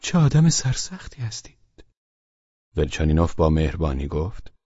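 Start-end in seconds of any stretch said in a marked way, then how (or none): 0.0-1.9 higher, teasing with a sly smile; sly needling. Acted. Pavel Pavlovich teasing Velchaninov with a sly, unsettling smile. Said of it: "با لبخندی ناراحت کننده".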